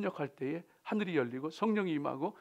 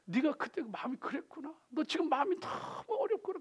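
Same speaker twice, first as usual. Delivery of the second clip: gentle listening; 9 semitones higher, 8 dB quieter than usual. Retold or imitated. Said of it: "그냥 들어주는 거예요".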